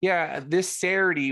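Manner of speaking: put-on high, loud Delco accent; broad Philly-area Delco accent. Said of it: "real Delco accent".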